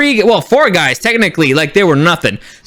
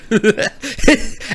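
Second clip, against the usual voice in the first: high, light laugh; breaking into giggles; 4 semitones higher, 4 dB quieter than usual. Real, not acted. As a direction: let a quick giggle escape. Laughs mid retelling.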